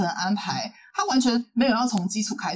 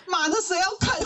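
high-pitched, laughing dismissal; mock scornful refusal; 10 semitones higher than usual. Speaker reacts to being recommended a volleyball anime, laughing scorn. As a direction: scoff it as a comic inner outburst. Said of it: "我就想说".

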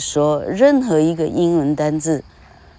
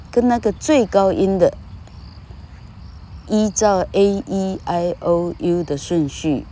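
ordinary talk, nothing marked

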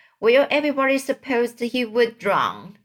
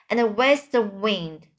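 ordinary delivery

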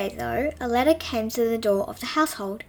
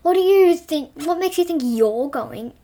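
loud, higher mocking scorn; sneering; 5 semitones higher, 6 dB louder than usual. A narrator's reading. Older brother mocking Cinderella, loud sneer. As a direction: sneer down at her. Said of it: "sneered Charlie".

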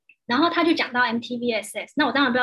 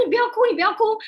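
excited comforting; 9 semitones higher, 3 dB louder, faster than usual. Retold or imitated. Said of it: "我说".

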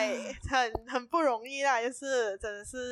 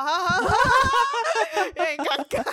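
awkward giggling, higher and louder; nervous laugh; 12 semitones higher, 7 dB louder than usual. Real, not acted. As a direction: laugh off the embarrassment.

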